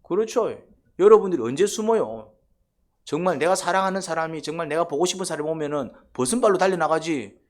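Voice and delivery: ordinary talk, nothing marked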